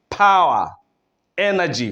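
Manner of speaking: normal talking voice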